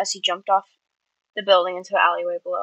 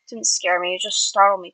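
ordinary delivery, nothing marked

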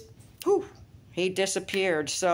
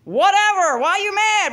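high, loud indignant defense; mock exasperated protest; 11 semitones higher, 5 dB louder than usual. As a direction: protest as if wrongly blamed. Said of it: "They're like".

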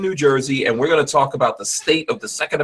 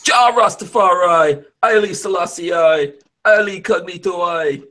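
slow, higher mock Jamaican chant; deep Jamaican accent; 5 semitones higher, slower than usual. Host showing off his Jamaican accent to guests, hammed-up. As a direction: ham up a Rasta Jamaican accent. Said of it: "I can go deep with the Jamaican accent".

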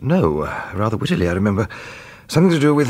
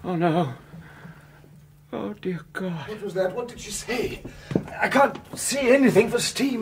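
hushed then higher in dismay; hint of shocked alarm; 11 semitones higher, 5 dB quieter than usual. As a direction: react to the scene with alarm. Shocked voices at bathroom.

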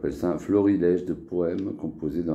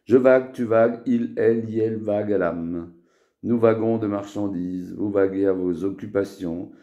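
higher-pitched chanted conjugation; poem recitation; 4 semitones higher, 5 dB louder than usual. Acted. Poet conjugating a made-up verb, poem recitation. Récite son dernier poème.

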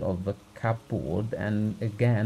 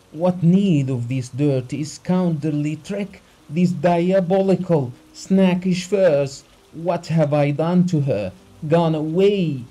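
loud, raised, outraged tirade; anguished yelling; 6 semitones higher, 6 dB louder than usual. A narrator's reading. Betrayed husband shaking his fists at the doctor, yelling. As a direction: yell out the betrayal, high and forceful. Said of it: "went on yelling".

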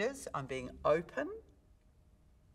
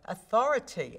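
AUTHORITY pushed out loud, lifted then falling; loud, higher burst on AUTHORITY, then down; letting the word go; 7 semitones higher, 8 dB louder than usual. Shown as a demonstration. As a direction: release AUTHORITY from the belly. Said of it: "letting the words go".

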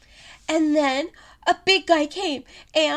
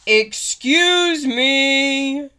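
louder and slow; booming excuse me; 6 dB louder, much slower than usual. Acted. Big guy booms politely to the little ones. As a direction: boom it out politely.

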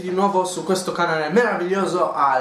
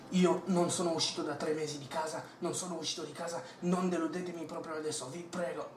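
desperate whisper; 11 dB quieter, faster than usual. Acted. Obsessed gamer pleads.

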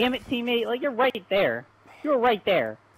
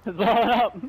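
urgent warning, louder; panicking gamer; 6 semitones higher, 4 dB louder than usual. Real, not acted. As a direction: call it out fast and alarmed. Alarm at an exploding RC car.

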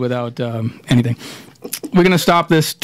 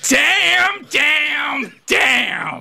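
Gilbert Gottfried; 9 semitones higher, much slower than usual. Retold or imitated. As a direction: shriek the words, then let the last one drop. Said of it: "as Gilbert Gottfried would say".

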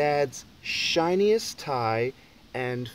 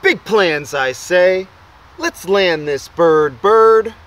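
commanding call; 2 semitones higher, 10 dB louder, slower than usual. Acted. Boy orders landing.

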